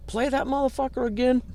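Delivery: put-on voice, far from his own; imitating Alan. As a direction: do the label boss's voice in a retold order. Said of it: "he looked around and he goes".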